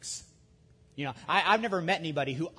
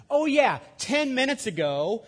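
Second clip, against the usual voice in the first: high, loud and slow, a dawning "oh yeah"; the penny drops, exclaim it slowly; sudden realization; 9 semitones higher, 8 dB louder than usual. Pretend. Servant realizing his own forgiveness.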